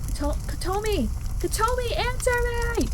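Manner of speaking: high, strained calling out; desperate shouts. Acted. Hero searching burning rooms shouts for Kotomi, desperate. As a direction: beg her to answer, shouting. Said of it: "my desperate shouts".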